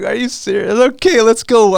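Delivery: can't believe it, then jumps in with her; amused surprise. Retold or imitated. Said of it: "I'm like, I was like".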